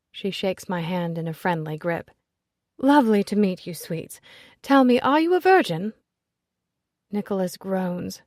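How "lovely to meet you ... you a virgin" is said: loud, high, teasing aristocratic friend; brash society lady. Acted. Ezzy greeting Nicholas at the party, loud and teasing. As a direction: greet him with a bawdy, affectionate jab.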